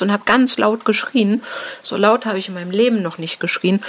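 ordinary talk, nothing marked

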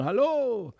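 merry high huntsmen's cry; hunters calling. A narrator's reading. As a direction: call out like tiny huntsmen.

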